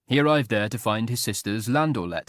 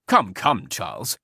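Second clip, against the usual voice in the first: reproving; 4 dB louder, slower than usual. A narrator's reading. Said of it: "said his lordship reprovingly".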